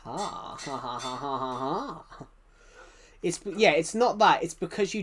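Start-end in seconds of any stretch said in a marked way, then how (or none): 0.0-2.2 a hearty pirate-like ha ha ha; pirate laugh. Real, not acted. Streamer laughs at chat like a pirate. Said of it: "That laugh is like a pirate".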